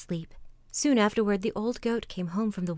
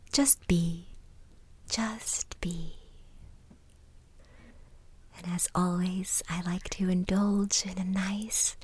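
guided meditation. Acted. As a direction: ease them gently into calm, with long pauses. Leads a relaxation exercise.

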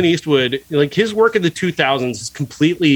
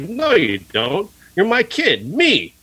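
higher, overacted father voice; mock movie father, pitched up and hammy; mock dad; 6 semitones higher, slower than usual. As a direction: ham up the clumsy dad reassuring his son. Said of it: "who the fuck talks like this?".

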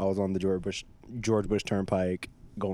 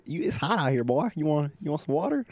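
higher, lightly southern helpful stranger; imitating a southern drawl; 7 semitones higher, 3 dB louder than usual. Stranger offering water in the heat, light southern accent. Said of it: "He said".

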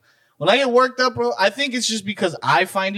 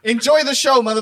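loud, high comic retort; mock yelling; 8 semitones higher, 4 dB louder than usual. Ranter insisting to his girl, mock yelling. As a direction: belt it out, half laughing. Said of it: "Why are you yelling at me?".

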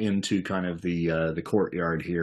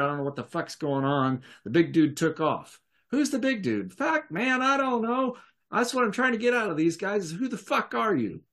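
higher-pitched, agitated tough guy; a confused outlaw demanding answers, pitched up; rough gangster NPC; 10 semitones higher than usual. Acted. GM voices NPC Seth Gecko.

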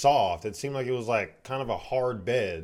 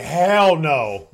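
loud, emphatic mock outrage with laughter behind it; retold aggressive outburst, loud; 4 semitones higher, 10 dB louder than usual. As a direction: blurt out an aggressive refusal, half laughing. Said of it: "You got really aggressive".

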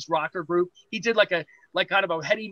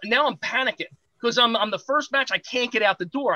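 ordinary talk

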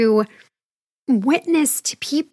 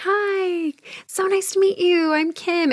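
much higher, sing-song polite hello; turning to a church neighbor, higher fake politeness; fake polite greeting; 7 semitones higher than usual. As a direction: parody an inauthentic greeting. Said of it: "so inauthentic to turn and be like".

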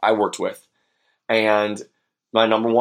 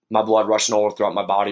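ordinary talk

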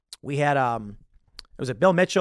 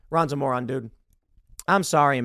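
ordinary talk, nothing marked

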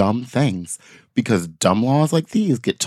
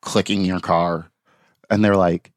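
normal talking voice

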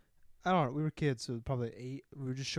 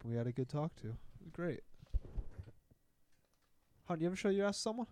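normal talking voice

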